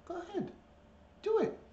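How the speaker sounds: very high-pitched urging; pet-talk voice. Real, not acted. Owner urging a shy bird on, high pet-talk. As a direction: egg the bird on in a high little voice.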